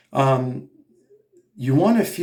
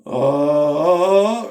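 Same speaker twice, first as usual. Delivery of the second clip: loud, nasty throaty "oh", rising in pitch; stuck in throat; 3 semitones higher, 6 dB louder than usual. Shown as a demonstration. Coach shows what we never want, throaty "oh". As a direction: push a closed-off "oh" from the throat. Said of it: "it's all stuck in the throat, sounds nasty".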